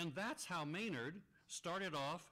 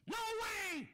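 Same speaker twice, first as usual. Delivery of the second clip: squeaky, much higher crow voice; 5 dB louder than usual. Acted. The crow refuses the moose in a high squeak. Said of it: "say the crow".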